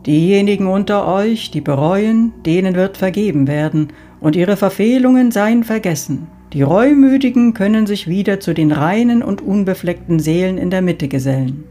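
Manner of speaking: God proclaiming. Acted. God rising before the souls, loud solemn proclamation. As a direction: pronounce forgiveness over the crowd.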